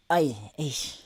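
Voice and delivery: low, soft hesitant groan; reluctant boyfriend. Retold or imitated. Boyfriend dodging his girlfriend's offer, quietly reluctant. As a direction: mimic him dodging, hushed and low. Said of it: "This brew is like".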